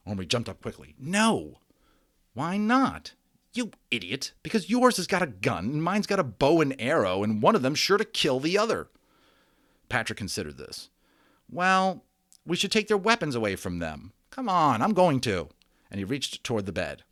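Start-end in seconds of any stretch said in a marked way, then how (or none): 1.0-8.9 put-on higher, louder urgent boy protesting; alarmed boy. A narrator's reading. Omri stops Patrick from putting the figures together, alarmed. 11.5-15.5 put-on higher, louder pushy boy; eager boy. A narrator's reading. Patrick insists on taking the weapons away, eager.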